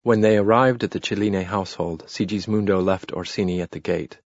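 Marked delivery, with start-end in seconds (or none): none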